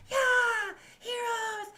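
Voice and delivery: high, loud tavern cheer; crowd cheering. Retold or imitated. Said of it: "cheering, like".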